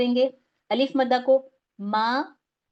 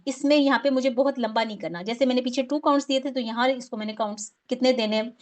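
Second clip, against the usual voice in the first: usual speaking voice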